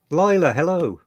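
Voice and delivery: ordinary talk, nothing marked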